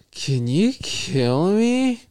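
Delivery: imitated sing-song request, far from gloomy; not depressed, almost inviting. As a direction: stretch it out with a high, lifting lilt. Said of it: "not, like, in, like, a depressed tone. It's almost like".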